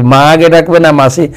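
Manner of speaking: usual speaking voice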